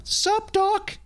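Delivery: put-on high-pitched Canadian woman's voice, broad accent; hammed-up Canadian lady from the sidelines. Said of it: "a Project Grizzly Canadian woman".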